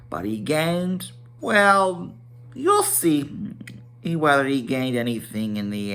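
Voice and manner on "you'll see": usual speaking voice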